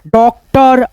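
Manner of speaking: ordinary talk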